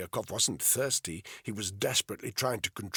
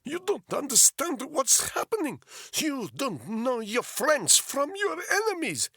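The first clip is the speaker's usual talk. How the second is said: put-on high, insistent Russian-accented English, rising louder; thick Russian accent; 5 dB louder than usual. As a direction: press the question in a heavy Russian accent. Polyakov voiced throughout.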